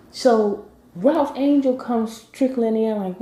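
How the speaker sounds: usual speaking voice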